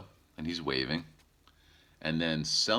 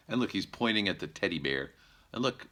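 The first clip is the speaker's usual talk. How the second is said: ordinary delivery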